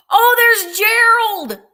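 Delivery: real excited greeting; delighted gasp. Real, not acted. Delighted at spotting a friend.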